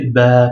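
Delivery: "Ba" with a long sustained vowel; prolonged A. Shown as a demonstration. Teacher demonstrating the long A after alif, "Ba" drawn out. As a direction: hold on to the a in "Ba". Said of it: "qu'il faut prolonger le A".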